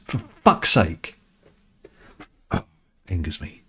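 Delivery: ordinary delivery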